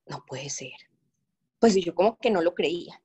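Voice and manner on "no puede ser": softer, almost breathed in awe; a hushed, lower "no puede ser"; stunned disbelief. Retold or imitated. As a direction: murmur it as if you can't believe it. Retelling her stunned disbelief.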